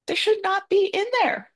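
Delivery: high, indignant burst of outrage. Retold or imitated. To herself at the trash bin, indignant. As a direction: let the outrage shoot up. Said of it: "I'm angry".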